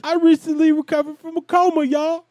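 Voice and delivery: high, loud, excitable Tracy Morgan impression; a high, loud Tracy Morgan bit. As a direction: pitch it way up and push it out like Tracy Morgan. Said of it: "'cause I'm Tracy Morgan, y'all".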